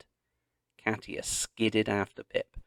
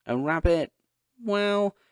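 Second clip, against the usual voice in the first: hesitant; 11 semitones higher, 6 dB louder than usual. A narrator's reading. Dad searching for words.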